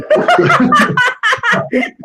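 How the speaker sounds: sudden high, loud laugh; real high-pitched laugh; high burst of laughter. Real, not acted. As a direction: crack up laughing. Laughing at lawsuit joke.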